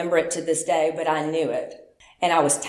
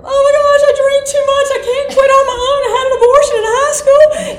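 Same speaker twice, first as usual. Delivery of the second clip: high, loud tearful outpouring, much higher and louder; crying confession; 8 dB louder, faster than usual. Retold or imitated. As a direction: blurt it all out through tears, loud and high. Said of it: "and I'm crying".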